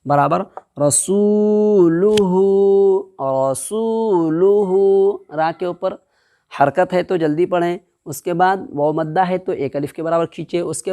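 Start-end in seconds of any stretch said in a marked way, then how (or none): 0.0-5.2 रसूलुहु very slow, each vowel drawn, higher; correct recitation. Shown as a demonstration. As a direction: recite रसूलुहु slowly, stretching the long u. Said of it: "तो कैसे बोलेंगे".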